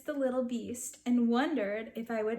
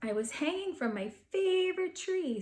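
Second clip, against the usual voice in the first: ordinary delivery, nothing marked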